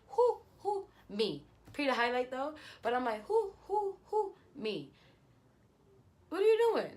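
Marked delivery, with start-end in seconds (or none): 0.1-0.9 squeaky high repeated who; calling out the ex, pitched very high; incredulous. Retold or imitated. Mock demanding who. 3.2-4.4 squeaky high repeated who; calling out the ex, pitched very high; incredulous. Retold or imitated. 6.3-6.9 very high, loud "what are you doing"; scolding the ex, pitched very high and loud; exasperated. Real, not acted. Outraged demand.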